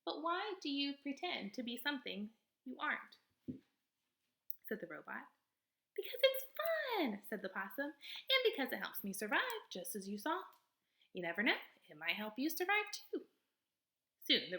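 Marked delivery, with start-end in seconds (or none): 0.0-3.1 higher, even robot voice. Acted. Robot answering the possum in a higher, even voice. 5.9-13.3 high, lively possum voice, theatrical; a showy little stage actor of a possum; possum character voice. Acted. Possum boasting to the robot, high and theatrical.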